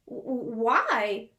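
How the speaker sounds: slow stammering why; sputtering. Acted. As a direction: stumble over the w. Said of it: "he sputtered".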